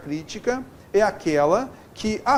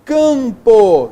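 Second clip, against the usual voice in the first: "campo" loud, first syllable drawn out; stressed nasal syllable; 9 dB louder than usual. Shown as a demonstration. Teacher showing a nasal tonic, "campo" loud with long "cam". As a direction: punch and hold the "cam" of "campo". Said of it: "sílaba tônica nasal".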